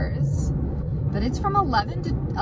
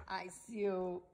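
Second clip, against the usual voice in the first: ordinary talk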